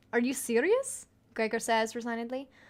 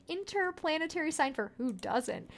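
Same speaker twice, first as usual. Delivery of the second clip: ordinary talk, nothing marked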